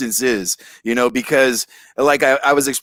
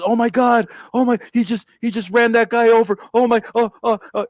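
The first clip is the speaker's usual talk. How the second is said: high, gasping imitation of shocked bystanders; mock panic; 8 semitones higher, faster than usual. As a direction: squeal it like a startled onlooker.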